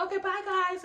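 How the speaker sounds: high, cheery sign-off; cheerful high-pitched send-off; cheerful goodbye. Retold or imitated. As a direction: call out a breezy, upbeat goodbye. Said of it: "being cheerful".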